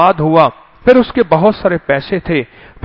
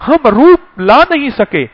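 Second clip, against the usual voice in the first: ordinary talk, nothing marked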